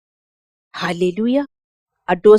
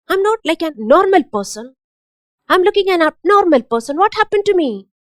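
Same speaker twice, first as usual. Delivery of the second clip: higher and louder, rushing complaints; mock frustrated outburst; 6 semitones higher, 5 dB louder than usual. Preacher acting out a depressed, irritable believer, higher and louder. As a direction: vent like someone irritated for no reason.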